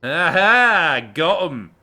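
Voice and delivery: loud gleeful crow; triumphant. Real, not acted. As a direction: crow over the burn loudly. Triumphant after burn.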